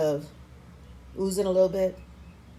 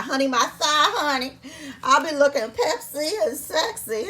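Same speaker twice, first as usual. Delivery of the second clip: higher, louder sassy flaunt with a laugh; sassy diva; 8 semitones higher, 5 dB louder than usual. Pretend. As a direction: strut it like a diva showing off.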